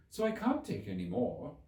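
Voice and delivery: put-on a touch of offended girl. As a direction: finish the retort, mildly hurt. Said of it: "in an offended tone".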